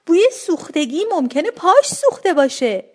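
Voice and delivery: mildly shouted alarmed guess; acted yell. A narrator's reading. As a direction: call it out high and drawn-out. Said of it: "داد زدم".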